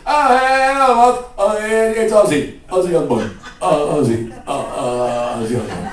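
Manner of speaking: loud rock-star caricature, starting high and sinking. Retold or imitated. Rock singer introducing himself by phone, loud bumbling caricature. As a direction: blare the greeting, then slur down through the name.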